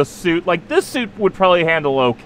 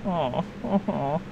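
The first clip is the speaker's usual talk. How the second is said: soft aww; 12 dB quieter, much slower than usual. Real, not acted. Reacting to being called cute.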